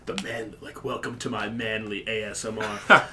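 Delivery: deep, soft macho whisper; a low, breathy tough-guy murmur; manly ASMR voice. Retold or imitated. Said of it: "Iggy Manley, it's like".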